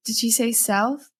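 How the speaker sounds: normal talking voice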